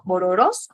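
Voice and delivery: bororós, stress landing on the final ó; bororós with a rising final ó; stress on last syllable. Shown as a demonstration. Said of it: "en la última O".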